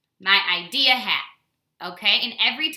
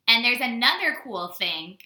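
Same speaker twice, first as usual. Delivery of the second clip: ordinary talk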